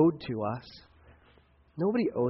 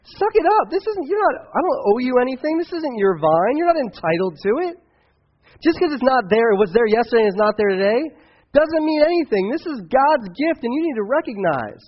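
much higher, much louder furious shout; God yelling; 10 semitones higher, 9 dB louder than usual. Retold or imitated. God shouts down whining Jonah, a loud angry rebuke. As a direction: blast him, suck it up. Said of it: "God's like".

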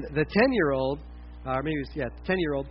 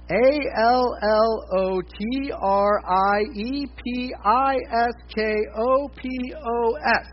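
very slow, letter by letter, higher pitch; spelling out; 4 semitones higher, much slower than usual. Shown as a demonstration. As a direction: spell it out slowly so listeners can follow. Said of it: "I'm just gonna spell it for you".

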